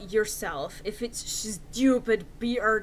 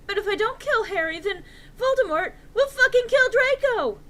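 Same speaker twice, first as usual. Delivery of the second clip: distraught rant; 11 semitones higher, 6 dB louder than usual. A narrator's reading. Distraught girl rants before bursting into tears.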